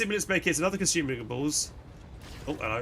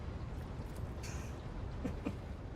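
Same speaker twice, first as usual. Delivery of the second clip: breathy laugh; 16 dB quieter than usual. Real, not acted. Reader laughs at own voice.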